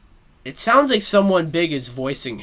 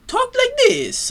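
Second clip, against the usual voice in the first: high-pitched cartoon character voice; Mario; 3 dB louder than usual. Fan showing why a whole movie in this voice is weird, high cartoon impression. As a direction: put on a squeaky-high mascot voice. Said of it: "it's weird hearing Mario".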